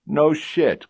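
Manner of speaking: high, loud mocking jeer; sarcastic cop. Fat partner mocking the detective, sarcastic. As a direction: scoff at the excuse.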